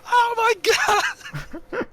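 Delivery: high, loud mock panic; panicked cry. Ginny reacting to the flooding, high panicked cry. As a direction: shriek it in mock horror. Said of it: "Ginny's like".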